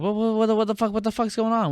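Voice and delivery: high, stammering disbelief; mock bewildered outburst. Acting out Pacino on the phone.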